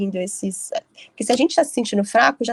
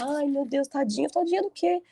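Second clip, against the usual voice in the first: mother's pity; 5 semitones higher, 5 dB quieter than usual. Retold or imitated. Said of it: "Minha mãe fica".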